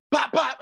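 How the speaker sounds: high, quick mimicked punch pops; playful high-pitched one-two; punch sounds. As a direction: pop out two imitated jabs. Imitates two punches landing.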